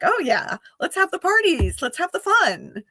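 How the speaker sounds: high, lilting party enthusiasm; playful party-loving Venus. Acted. Venus in Gemini calling for parties and fun, playful enthusiasm. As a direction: pitch it up like someone keen for fun. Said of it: "Venus is just like".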